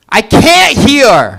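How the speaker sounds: put-on brash New York accent shout. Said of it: "a deaf guy in New York".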